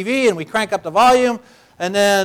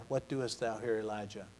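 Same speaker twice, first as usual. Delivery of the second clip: hushed divine voice; 8 semitones lower, 18 dB quieter than usual. Acted. God's quiet voice in Scripture.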